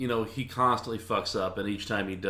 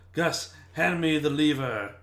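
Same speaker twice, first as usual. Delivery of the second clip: slow, higher put-on commanding voice; magician to assistant; 6 semitones higher, 4 dB louder, slower than usual. Magician ordering Gus the cat to assist, slow mock command. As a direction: playfully order it, drawing the words out. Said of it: "He would just go".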